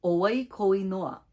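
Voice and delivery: "Oi ko inoa" with pitch falling at the end, not rising; falling question tune. Shown as a demonstration. Teacher showing learners the falling Hawaiian question tune. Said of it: "it sounds like this".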